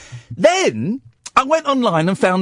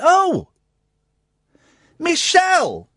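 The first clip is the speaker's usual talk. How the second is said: mock surprise; 9 semitones higher, slower than usual. Feigned surprise at scammer's name.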